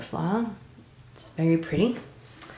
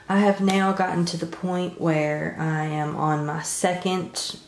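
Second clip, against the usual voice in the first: ordinary talk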